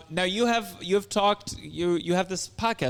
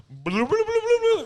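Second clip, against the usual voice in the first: a fake reveal hum climbing way up; mock fanfare; much slower than usual. Imitating a hacky comic's reveal build-up.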